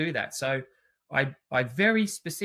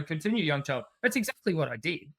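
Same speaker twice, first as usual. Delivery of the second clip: ordinary delivery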